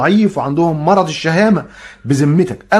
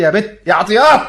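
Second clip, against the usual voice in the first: louder call to Attiyat, pitched up; 4 semitones higher, 5 dB louder than usual. Acted. Calling out for Attiyat to come help. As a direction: call her name across the house.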